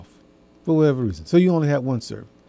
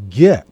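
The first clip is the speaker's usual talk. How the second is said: "get." said alone, punched louder; stress on get; 4 semitones lower, 5 dB louder than usual. Shown as a demonstration. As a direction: hit the word "get" hard, on its own. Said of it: "notice that I emphasize the word".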